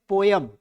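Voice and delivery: पोयम in two syllables, "po-yam"; slow two-beat पोयम; wrong reading of poem. Shown as a demonstration. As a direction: say पोयम with a y glide in the middle. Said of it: "इसको पोयम नहीं पढ़ते".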